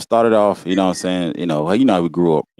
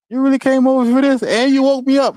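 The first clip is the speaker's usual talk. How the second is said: much higher, incredulous mock questioning; incredulous uncle. Uncle to nephew who came over for a whipping, incredulous. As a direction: imitate a woken-up uncle's disbelief, pitch way up. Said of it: "He look at me like".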